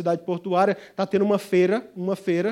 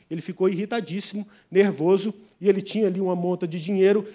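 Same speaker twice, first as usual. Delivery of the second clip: ordinary delivery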